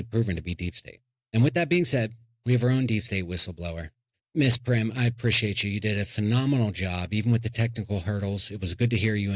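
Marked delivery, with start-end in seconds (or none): none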